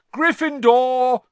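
big, high, drawn-out shout; shouted announcement. A narrator's reading. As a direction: proclaim it slowly to the whole hall. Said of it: "the hat shouted".

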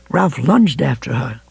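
usual speaking voice

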